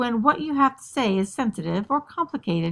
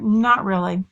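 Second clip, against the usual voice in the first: loud one-word brush-off; teenager's terse, louder reply; curt teen; 5 dB louder than usual. Acted. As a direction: shut mom out with a single word. Acted teen shuts mother out.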